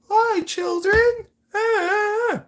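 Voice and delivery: very high, loud, drawn-out clown voice; creepy clown. Acted. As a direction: squeal it slowly like a mock creepy clown. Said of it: "rainbow clown makeup".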